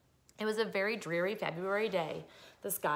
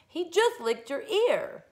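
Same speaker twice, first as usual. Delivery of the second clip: put-on high, slow, louder child voice; little sister; 10 semitones higher, 5 dB louder, slower than usual. Sister correcting the crybaby, high child voice. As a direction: scold like a small kid.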